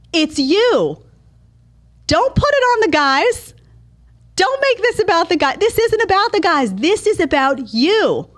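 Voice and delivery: high, pressing insistence; emphatic scolding. Real, not acted. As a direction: drive the point home hard. Scolding the women listeners.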